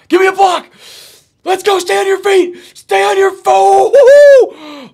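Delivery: real ecstatic cheering; excited screaming. Real, not acted. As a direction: scream the runner on, all out. Long run, screaming for blockers.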